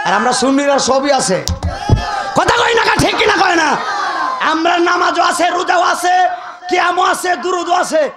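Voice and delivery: preacher shouting to his audience; fiery preaching. Acted. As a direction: rouse the crowd at full force. Preacher rallying the crowd.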